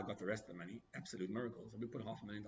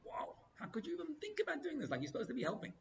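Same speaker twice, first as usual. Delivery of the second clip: sharp, much higher voice of disbelief; exasperated outburst. Retold or imitated. Mad at the guy blocking the yeshiva, exasperated outburst. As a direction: throw up your hands at him. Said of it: "I was so mad at him".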